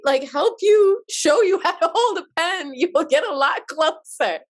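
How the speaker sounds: soothing grandfather. Retold or imitated. Grandfather teaching toddler to hold a pen, high soothing voice. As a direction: coax a crying toddler kindly.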